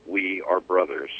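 soft, hushed line inside the poem; a soft, hushed "We are brothers". A narrator's reading. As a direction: drop to a near whisper for the brothers line. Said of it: "and he whispered".

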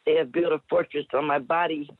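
measured, rhythmic poetry performance; dramatic spoken word delivery; spoken word poem. Acted. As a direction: perform the poem with slow weight.

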